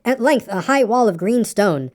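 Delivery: plain reading voice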